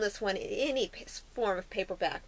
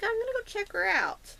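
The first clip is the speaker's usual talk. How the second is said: higher, bright "let's go" prince voice; eager young prince; 10 semitones higher than usual. Retold or imitated. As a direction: toss it off like a curious young prince, pitched up. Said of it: "He's like".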